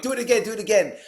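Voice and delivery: high eager pleading; begging like a kid at a magic show; excited. Retold or imitated. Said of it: "I'm like".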